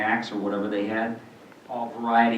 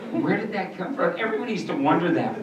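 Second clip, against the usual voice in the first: ordinary talk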